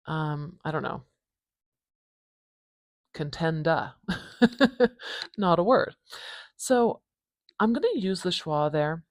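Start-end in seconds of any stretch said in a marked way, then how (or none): 4.0-5.3 bright laugh at her own joke; a short amused laugh; high laugh. Real, not acted. Laughs at made-up word.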